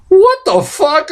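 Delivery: high, slow, stunned outburst; shocked disbelief. Retold or imitated. Reviewer reacting to a robot's head ripped off, shocked. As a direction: draw it out in appalled amazement.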